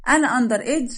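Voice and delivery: high, loud affronted question; indignant disbelief. Acted. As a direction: throw it back, offended. Bride insulted at being called underage. (استنكار بصوت عالٍ ومرتفع)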